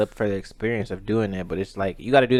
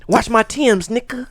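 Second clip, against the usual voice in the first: mock girl in bed; 12 semitones higher, 7 dB louder than usual. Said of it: "as the girl calling me".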